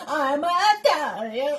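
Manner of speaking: loud, drawn-out mock Italian accent. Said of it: "And then she's all like".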